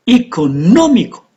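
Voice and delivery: "economical" with NO high and strong, then falling; stress on NO. Shown as a demonstration. Teacher demonstrating word stress, "economical" stressed on NO. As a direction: punch the third syllable of "economical", eco-NO-mical. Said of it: "read these words, but with stress".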